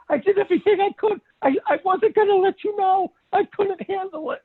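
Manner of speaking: high, fast, breathless confession; flustered client. Retold or imitated. The woman confessing she knew Joe, high and fast. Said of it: "She said".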